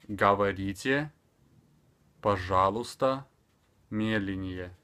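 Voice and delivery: "Говорите, пожалуйста, медленнее" slowly, one word at a time; long pauses between clearly drawn-out words; extremely slow. Shown as a demonstration. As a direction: dictate it slowly to a learner. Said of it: "speaking extremely slower".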